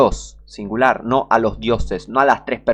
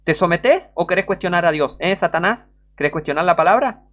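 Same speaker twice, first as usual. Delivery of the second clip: raised, loud preaching voice; fiery preaching; 6 semitones higher, 4 dB louder than usual. Real, not acted. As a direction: preach it hard at the congregation. Preaching at full force. (predicación encendida y más fuerte)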